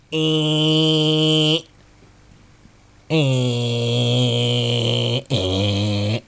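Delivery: imitated strimmer buzz. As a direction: imitate a tiny strimmer with your voice. Tiny strimmer or monkey noise.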